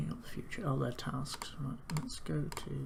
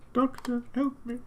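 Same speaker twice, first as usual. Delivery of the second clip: mock cry for help; 10 semitones higher, 4 dB louder than usual. Mock plea to game doctor.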